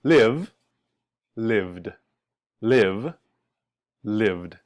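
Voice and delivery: "lived" as one syllable ending in voiced "d"; D ending demonstrated. Shown as a demonstration. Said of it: "pronounced with just the le-, sound D".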